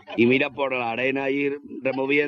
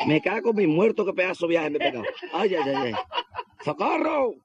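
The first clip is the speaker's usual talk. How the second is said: comic high wailing at moderate volume; mock yelling; 6 semitones higher than usual. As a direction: act out a fallen man crying for help. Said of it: "Y el gacho pegando gritos".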